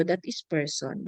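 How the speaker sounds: ordinary talk, nothing marked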